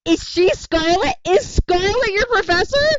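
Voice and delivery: high, loud excited shout; excited yell. Real, not acted. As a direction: blurt it out at full volume in excitement. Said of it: "I don't mean to yell".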